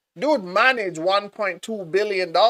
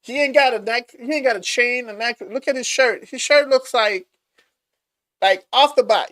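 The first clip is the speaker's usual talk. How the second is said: hyped up; 8 semitones higher, 4 dB louder than usual. Real, not acted. Hyping the casual billionaire.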